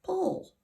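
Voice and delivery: "pull" as the ending of apple; "pull" pronounced like -ple, standing by itself; isolated syllable. Shown as a demonstration. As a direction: say "pull" alone, loud and distinct. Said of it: "Second syllable".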